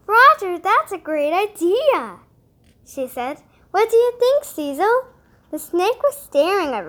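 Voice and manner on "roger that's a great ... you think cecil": higher, louder, eager cat voice; excited cat. Acted. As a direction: bubble over with excitement. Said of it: "swished her tail in excitement".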